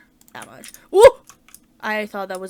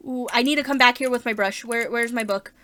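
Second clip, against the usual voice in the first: ordinary talk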